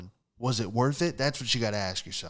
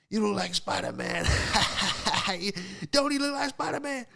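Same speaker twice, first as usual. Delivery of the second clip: high put-on woman laughing at him; hammed-up laughing lady impression; mock cackling laugh; 10 semitones higher, 3 dB louder than usual. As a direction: crow it through a mocking cackle. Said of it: "she starts doing the laugh".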